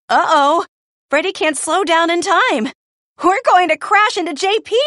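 high, urgent cry of warning; alarmed cry. A narrator's reading. Pip warns of a crash into J P, alarmed. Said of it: "Pip cries".

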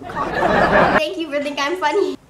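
talking through laughter; laughing. Real, not acted. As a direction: laugh while thanking. Laugh heard.

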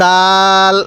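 firm "d", then a long level vowel in দাল; hard দাল. Shown as a demonstration. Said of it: "দালটাও আমরা শক্তভাবে উচ্চারণ করব".

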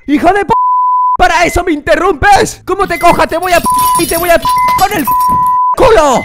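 loud, high outraged yelling; furious rant. Acted. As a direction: explode at the caller who interrupted you. Furious at prank call interrupting song.

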